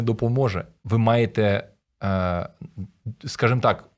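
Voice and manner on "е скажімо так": ordinary talk, nothing marked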